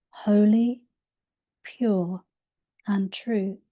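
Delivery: ordinary delivery, nothing marked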